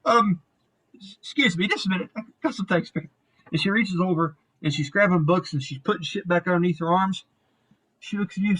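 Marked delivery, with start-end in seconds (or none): none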